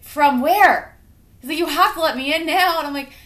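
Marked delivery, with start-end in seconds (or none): 0.0-1.0 higher, louder startled dad; alarmed dad. Retold or imitated. 1.6-2.8 higher, louder demand; insistent dad. Retold or imitated.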